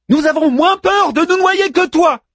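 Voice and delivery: very high theatrical shout; yelled taunt. A narrator's reading. Manmut keeps reciting over the storm, high yell. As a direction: throw the taunt out at high pitch.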